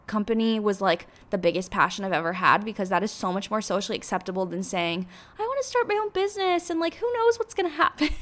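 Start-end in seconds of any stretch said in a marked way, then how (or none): 5.4-8.1 mocking high voice. Mocking how others hear her dream.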